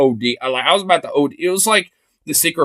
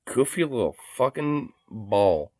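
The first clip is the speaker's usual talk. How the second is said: laughing softly; 7 dB quieter, slower than usual. Real, not acted. Laughing at the dog.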